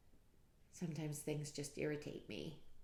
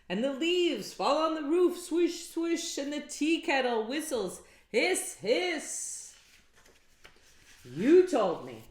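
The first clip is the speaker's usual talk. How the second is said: higher, louder exasperated rant; angry Peter; 9 semitones higher, 12 dB louder, slower than usual. Acted. Peter ranting at the wise men, exasperated. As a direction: complain in rising frustration. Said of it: "Now, Peter was angry".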